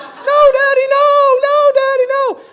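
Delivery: put-on high, loud crying child's wail; child yelling. Pastor imitating his daughter's loud wail. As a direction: wail it like a little girl being dragged off.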